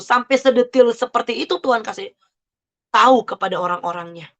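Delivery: ordinary talk, nothing marked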